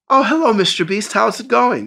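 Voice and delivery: cool and composed daughter. Retold or imitated. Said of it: "All cool and shit".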